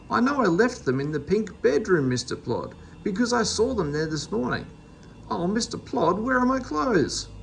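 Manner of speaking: high, bewildered begging; a mildly voiced fretful Meddle; pleading dismay. A narrator's reading. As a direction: appeal to Mister Plod.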